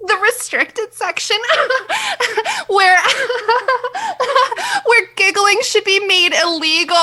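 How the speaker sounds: high giggly intro; giggling through every word; nervous laugh. Shown as a demonstration. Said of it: "like, a nervous laugh".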